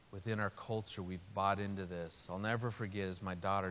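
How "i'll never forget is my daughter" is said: ordinary talk, nothing marked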